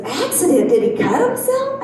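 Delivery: mother-in-law asking her son's wife, high and worried. Retold or imitated. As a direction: play the fretting mother.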